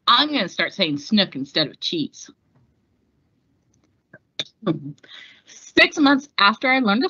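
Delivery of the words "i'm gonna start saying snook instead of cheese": normal talking voice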